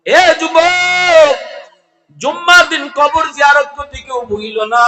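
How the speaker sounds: high, loud hail, first words drawn out; impassioned preacher's shout; shouted exhortation. Real, not acted. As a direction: cry out a warning to the whole crowd. Preacher exhorts the congregation's youth.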